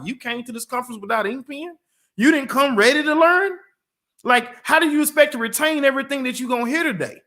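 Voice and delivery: high-pitched, disbelieving challenge; mock-outraged reproach, pitched well up; incredulous scolding. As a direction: scold them in rising, incredulous questions. Acted reproach to unprepared conference attendee.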